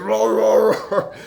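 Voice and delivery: high, loud imitated dog roaring; pit bull roar. Pit bull coming round the corner, imitated roaring. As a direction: roar like a big dog charging. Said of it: "He's like".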